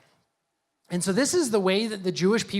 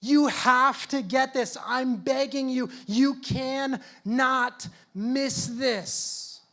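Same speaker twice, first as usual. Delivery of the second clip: higher, slow, earnest pleading; a higher, imploring plea; pleading Paul; 5 semitones higher, much slower than usual. Retold or imitated. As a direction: beg them not to miss it. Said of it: "saying, is begging them".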